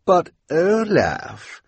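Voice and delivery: slow, high pleading drawl; Esme Squalor voice. Acted. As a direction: drag out the objection, high. Esme objecting to Olaf.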